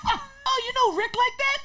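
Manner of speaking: high, loud mock amazement; squealed, laughing imitation of her surprise; impressed girl. Girl amazed at youngster's famous friend, mock shout.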